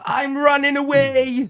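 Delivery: put-on high, slow whiny child. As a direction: wail it like a sulking kid. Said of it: "when a child goes".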